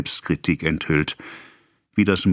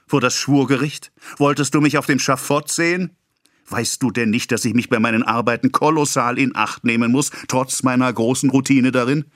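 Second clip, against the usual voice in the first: higher, dramatic indignation; self-pitying rant; 6 semitones higher than usual. A narrator's reading. Pharmacist ranting about his responsibility, higher. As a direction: paint the gallows for him.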